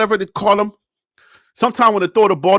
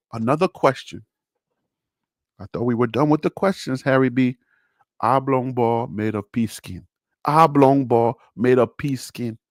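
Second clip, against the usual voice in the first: much lower, softer mock announcer aside; 10 semitones lower, 7 dB quieter than usual. Aside to viewers reading a fan question, low and soft. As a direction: drop the voice low and soft like a quiz narrator.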